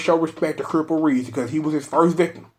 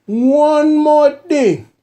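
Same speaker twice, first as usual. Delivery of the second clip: high, slow, booming tipsy uncle; 11 semitones higher, 5 dB louder, much slower than usual. Shown as a demonstration. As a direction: stretch it out like a loud uncle. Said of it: "One more thing in my uncle voice".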